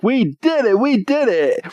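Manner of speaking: high, gleeful cheer; mock celebration. Heroes told they passed the trial, mock celebration. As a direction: cheer like a cartoon hero.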